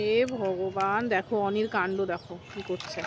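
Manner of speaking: oh God, look what he's done, resigned; exasperated sigh. Real, not acted. Mother exasperated at child's mess.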